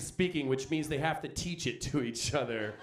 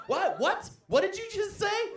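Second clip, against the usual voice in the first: incredulous. Acted. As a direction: act baffled at what you heard. Incredulous reaction to broadcaster.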